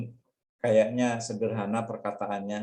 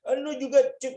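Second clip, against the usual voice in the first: mock retaliation; high retort snapped back; 13 semitones higher, faster than usual. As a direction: fire the insult right back. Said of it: "Kita mau balas nih".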